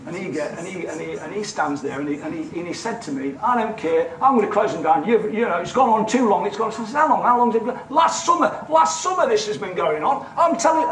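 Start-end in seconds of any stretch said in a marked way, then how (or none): 3.4-10.9 imitating an exasperated official, higher and louder; mock the officer who has had enough, raised voice; fed-up council officer. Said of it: "this is his attitude on the phone".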